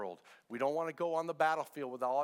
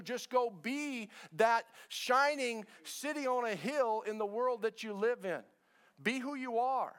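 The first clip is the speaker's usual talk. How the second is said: full-force preaching; 5 semitones higher than usual. Acted. Pastor urging the church to be who they are, preaching. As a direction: preach it out, building.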